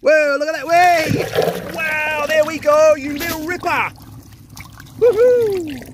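high, excited whoops of amazement; thrilled exclaiming. Real, not acted. Amazed at the cleared drain.

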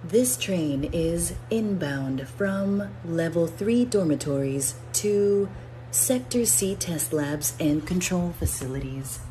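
even, measured transit announcer read; calm station announcer voice; automated announcement. Shown as a demonstration. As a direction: deliver it like a recorded train PA.

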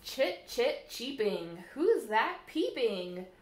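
lilting, sing-song storybook reading; bouncy picture-book read-aloud; reading to children. Acted. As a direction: read the rhymes to little ones at storytime. Reads rhyming picture book aloud.

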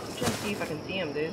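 normal talking voice

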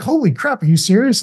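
high, breathy disbelief at a gorier story; mock shock. Parent reacting to another parent's story, mock shocked. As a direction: pitch up as if you can't believe it.

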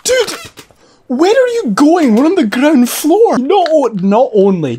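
high, loud and slow shouting at him; exasperated yell. Real, not acted. Yelling at the guy riding the elevator, exasperated shout. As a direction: shout at someone walking into danger.

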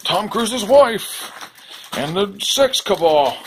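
high, slow put-on toy voice; Tiger Blanket voice. Stuffed tiger comparing it to a movie, slow high voice. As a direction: drag it out in the squeaky tiger voice. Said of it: "He's like".

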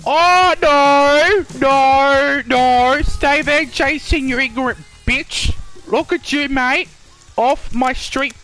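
loud, high outburst at an attacker; panicked yelling. Real, not acted. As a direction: yell the game villain away. Attacked by Jason in the game.

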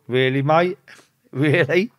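high mocking disbelief; sceptical. Thinking at the plainclothes cop, mocking disbelief. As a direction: scoff at the idea. Sceptical inner thought about copper.

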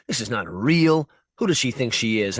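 usual speaking voice

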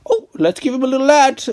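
very high, squeaky mocking voice; mock scheming ad-makers. Player imitating the game sneaking an ad in, mocking falsetto. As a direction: put on a silly falsetto to mock them.

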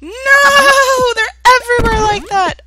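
very high, loud cries; panicked wailing. Real, not acted. Player finding burgers everywhere, panicked wail. Swamped by enemies.

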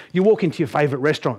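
ordinary talk

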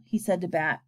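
usual speaking voice

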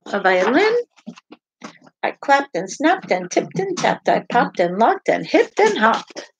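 lively, sing-song picture book read-aloud; animated storytime voice with playful sound words; reading to children. Acted. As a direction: read it out to little listeners with bounce.

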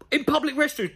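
high-pitched, disbelieving repeat; incredulous echo. Real, not acted. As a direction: throw the words back in disbelief. Incredulously echoes the line just read.

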